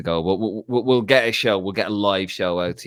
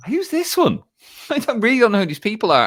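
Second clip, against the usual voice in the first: much higher, bewildered mock whine; baffled; 6 dB louder than usual. Baffled by random social media videos, mock bewildered. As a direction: act utterly lost at social media.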